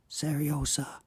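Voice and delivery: softly says the name, close and hushed; soft murmur in ear. A narrator's reading. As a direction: murmur it right into a sleeping child's ear. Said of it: "just in the child's ear".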